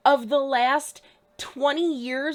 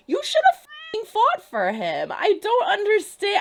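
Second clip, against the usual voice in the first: much higher, louder near-cry of frustration; half-crying, fed up with the character; frustrated outburst; 9 semitones higher, 5 dB louder than usual. Real, not acted.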